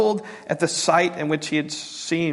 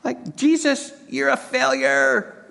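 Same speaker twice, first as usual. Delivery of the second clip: higher, taunting sneer at Jesus; a sneering skeptic in the crowd, much higher; mocking skeptics; 9 semitones higher than usual. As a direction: jeer like a scoffer in the crowd. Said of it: "some of them said".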